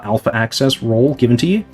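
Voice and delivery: ordinary delivery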